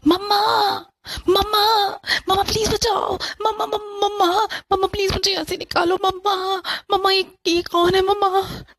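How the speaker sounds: high, loud, panicked cries for mother; a frightened girl shrieking for her mother; terrified screaming for mom. Acted. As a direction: scream for help, terrified and crying. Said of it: "मैं जोर से चिल्लाने लगी".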